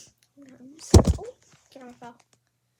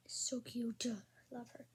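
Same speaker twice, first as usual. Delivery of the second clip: soft whispered adoration; whispering; 2 semitones lower, 7 dB quieter than usual. Real, not acted. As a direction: whisper it lovingly. Whispering over toy.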